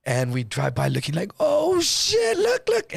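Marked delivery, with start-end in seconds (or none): 1.4-2.9 high-pitched hushed-crew gawking; retold startled exclamation; alarmed amazement. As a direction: react in shock, voice shooting way up. Acting out reaction driving past police.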